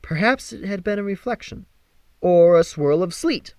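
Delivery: plain reading voice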